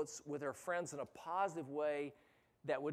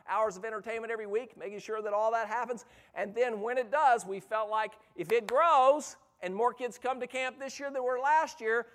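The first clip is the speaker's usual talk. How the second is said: raised, higher-pitched preacher's delivery; forceful preaching; 6 semitones higher, 8 dB louder than usual. Real, not acted. Preacher to youth workers about mediocre ministry, forceful preaching. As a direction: preach it with mounting force and volume.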